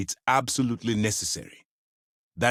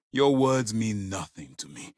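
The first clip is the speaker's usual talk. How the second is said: ordinary delivery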